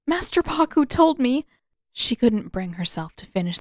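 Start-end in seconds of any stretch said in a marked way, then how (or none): 0.1-1.5 1 distressed, breaking off. A narrator's reading. Katara upset, breaking off.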